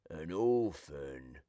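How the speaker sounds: put-on tender sorrow for the baby; pitying. A narrator's reading. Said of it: "says Burglar Bill".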